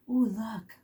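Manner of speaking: hushed whisper. Shown as a demonstration. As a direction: whisper in surprise. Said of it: "whispers to children".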